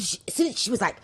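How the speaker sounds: usual speaking voice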